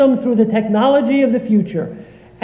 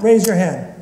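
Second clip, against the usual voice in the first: ordinary talk, nothing marked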